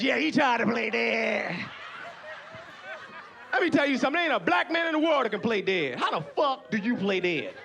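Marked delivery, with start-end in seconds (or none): 0.0-1.5 slow, drawn-out mock brag; bragging bear. Bear boasting to the other bears, bragging. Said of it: "gonna brag to the other bears".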